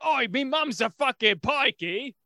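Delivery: loud, slow mock Cockney British yell; mock British accent. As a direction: drag it out like a rowdy British lout. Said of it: "probably the British version".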